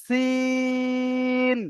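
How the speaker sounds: সিন with সি held long and ন short; long sin. Shown as a demonstration. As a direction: draw out সি then close on ন. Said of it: "সিটা বলে টানটা করতে হবে". (সি টেনে সিন)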